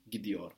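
Gidiyor with T softened to D; soft D in Gidiyor. Shown as a demonstration. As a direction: roll Gidiyor off the tongue with a D. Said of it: "making it easier to roll off the tongue".

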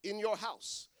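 ordinary delivery

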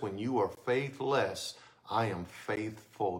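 slow, low, soft reassurance; tender. Acted. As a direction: reassure gently, like a hug. Quoting God as tender father.

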